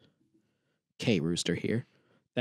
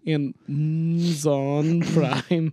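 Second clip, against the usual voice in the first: mock Russian accent; much slower than usual. Shown as a demonstration. Mock accent game continues.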